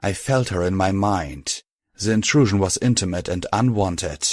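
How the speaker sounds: plain reading voice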